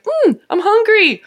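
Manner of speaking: high, singsong, carefree voice; playful imagined self. Acted. Acts out carefree passerby at a fridge.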